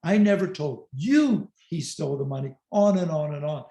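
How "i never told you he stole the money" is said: long, raised "you", the rest unstressed; stress on YOU. Shown as a demonstration. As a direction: hit the word "you" hard and hold it. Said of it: "emphasize a different word".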